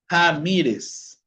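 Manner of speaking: slow "Ramírez" starting on a Spanish J; initial R as J. Shown as a demonstration. Teacher modelling Portuguese initial R for students, slow J-sound demo. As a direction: draw out "Ramírez" with the first R as an H-like jota. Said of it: "la R va a tener un sonido de J".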